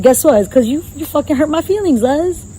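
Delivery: high, wounded mock complaint; hurt team captain, lightly voiced. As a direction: whine back like a hurt player. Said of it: "You're gonna be like".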